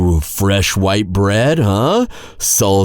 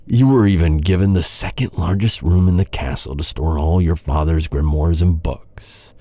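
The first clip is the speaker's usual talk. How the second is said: ordinary talk, nothing marked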